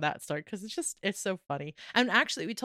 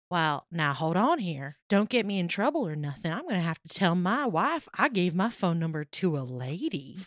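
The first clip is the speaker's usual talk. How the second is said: put-on old Southern gentleman. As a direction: imitate a polite old Southern man. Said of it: "very old Southern gentleman goes".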